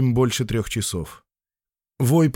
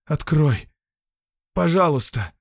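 weak pleading; 4 semitones higher than usual. Acted. Fainting man begs.

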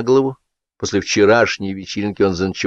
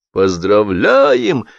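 buzzing table; 7 semitones higher, much slower than usual. A narrator's reading. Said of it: "загудели за столом".

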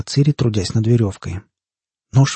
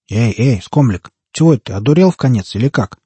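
usual speaking voice

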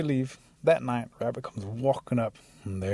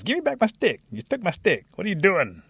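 high, louder storybook outrage; indignant demand; 9 semitones higher, 5 dB louder than usual. A narrator's reading. Rabbit whose stick got stuck, demanding it indignantly. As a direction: demand it back in a high, worked-up voice.

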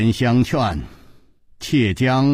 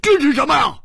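startled cry; faster than usual. Acted. Hu Tiehua finding the note under the vase, startled cry. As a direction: call them over in sudden alarm. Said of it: "一声惊呼说".

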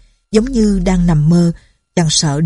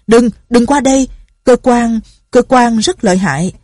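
loud, higher urgent cry to stop them; urgent warning; 5 semitones higher, 4 dB louder than usual. Acted. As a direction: yell a warning before they step closer. Urgent warning to approaching friends. (hét lên cảnh báo gấp gáp)

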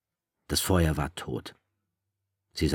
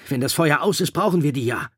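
higher, louder urgent call; 10 semitones higher, 7 dB louder than usual. A narrator's reading. Ralph calling to Maurice about the glasses, loud. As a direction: keep shouting the reason. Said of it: "Er hörte auf zu rufen".

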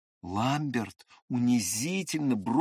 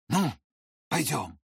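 winded and dazed. A narrator's reading. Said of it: "как бы с трудом переводя дыхание".

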